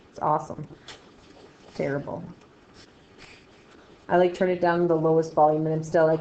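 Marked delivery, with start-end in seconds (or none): none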